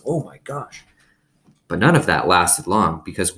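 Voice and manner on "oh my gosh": surprised. A narrator's reading. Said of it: "like he was real surprised".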